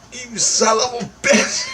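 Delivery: high, drawn-out mock outburst with a laugh; amazed cursing. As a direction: imitate a friend cursing you in disbelief. Acting friend's amazed morning greeting.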